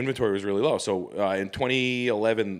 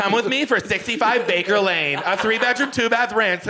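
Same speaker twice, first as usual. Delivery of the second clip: high, breezy realtor sales voice; mock realtor pitch; 10 semitones higher, 4 dB louder than usual. Parodying a realtor's house tour, high sales voice. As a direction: sell it like a cheesy listing video. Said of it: "mocking realtor listing video".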